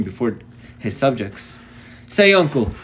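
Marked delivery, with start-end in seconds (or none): 2.1-2.7 stern repeat. A narrator's reading. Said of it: "Mark repeated".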